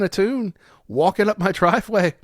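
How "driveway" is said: ordinary delivery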